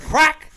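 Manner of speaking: high loud mock crack of a beer can; sharp imitated can-opening sound. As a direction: pop the word like a can opening.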